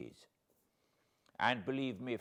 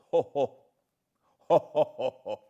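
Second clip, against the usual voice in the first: loud ho-ho laughter, bitter underneath; hearty laugh; 6 semitones higher, 9 dB louder than usual. Acted. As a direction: laugh out at the absurdity. Laughing at the grim irony.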